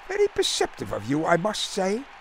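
put-on high, clipped English gent's accent; polite compliment in a lofty British accent. A narrator's reading. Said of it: "in his lofty, clipped accent".

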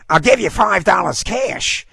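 high-pitched indignant woman; feisty dog owner, protesting. A narrator's reading. Dog owner disputes the fee at the counter, indignant. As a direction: argue back in a high, feisty voice.

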